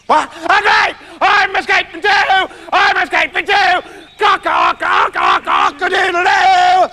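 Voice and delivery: high, shouted crowing speech; squawking, overjoyed rooster; excited rooster. Acted. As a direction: crow it out and end on a long cock-a-doodle-doo.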